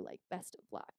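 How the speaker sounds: soft, whispered brush-off; a quiet, dismissive brush-off from the CEO. Retold or imitated. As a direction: let him down quietly. Voicing CEO rejection.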